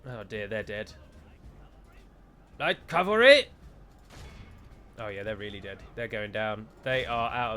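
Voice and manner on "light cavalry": loud, high shouted unit call; raised-voice commander's cry; battle call. Pretend. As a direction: call out to troops across a battlefield. Calling out troops during battle.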